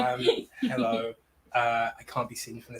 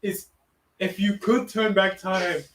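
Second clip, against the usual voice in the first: lightly voiced ghost, slow and pleading; 6 semitones higher, 5 dB louder, slower than usual. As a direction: plead gently from beyond. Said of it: "the ghost of your husband, [name]".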